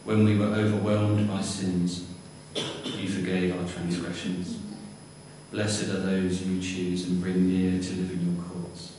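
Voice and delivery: ordinary delivery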